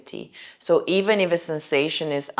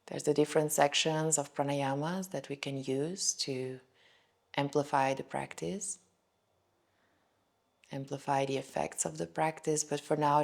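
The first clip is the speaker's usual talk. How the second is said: calm, softer voice with long pauses; guided meditation; 5 dB quieter than usual. Shown as a demonstration. Yoga teacher inviting students into a body scan, guided meditation. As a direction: lead gently through a body scan, settling softer as it goes.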